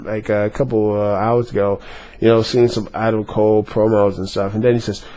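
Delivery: ordinary talk, nothing marked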